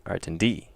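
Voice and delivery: Artindi stressed at the end, ar-tin-DI; stress on last syllable. Shown as a demonstration. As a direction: lift and stress the final DI of Artindi. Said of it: "If it's on the last one".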